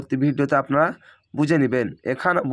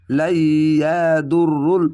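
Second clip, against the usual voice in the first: long drawn-out Quranic chant of লাইয়াদুররুল; slow recitation; 4 dB louder, much slower than usual. Shown as a demonstration. Teacher to students, slow chanted recitation. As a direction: stretch every syllable like reciting. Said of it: "teacher demonstrates Quran reading".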